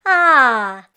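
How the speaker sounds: a grateful sprite bidding farewell; Pooka's voice. A narrator's reading.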